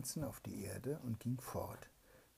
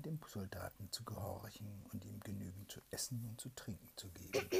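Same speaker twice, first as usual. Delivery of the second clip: hushed whispered storytelling; bedtime whisper; 4 dB quieter than usual. A narrator's reading. Mother reads a bedtime tale to her child, whispering.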